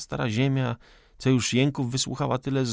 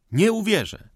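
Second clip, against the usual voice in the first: loud refusal; 9 semitones higher, 6 dB louder than usual. Acted. Forester's outburst.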